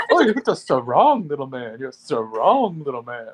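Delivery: slow, lightly voiced movie character. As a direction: drawl it out as the character. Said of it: "quoting movie character".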